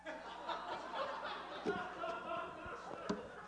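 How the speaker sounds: airy laugh at himself; high breathy laugh. Real, not acted. Pastor laughing at his own awkward answer, airy laugh. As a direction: laugh helplessly, breath only.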